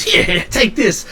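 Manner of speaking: high, eager urging; excitable shopkeeper. Acted. Shopkeeper handing a brass nut over, high and eager. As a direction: press it on them in a high character voice. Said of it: "looks at you and goes".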